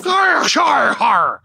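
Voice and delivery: loud, high pirate "har, har, har" shout; pirate laugh. Pretend. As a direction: bellow a hammed-up pirate laugh. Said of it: "It's, like, half pirate".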